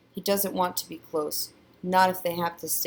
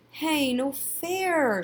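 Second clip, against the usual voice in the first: put-on high, drawn-out childish whine; slow whiny protest, stretching the words; whining; 9 semitones higher, much slower than usual. A narrator's reading. Sister beaten to the phone, whining. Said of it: "she whines".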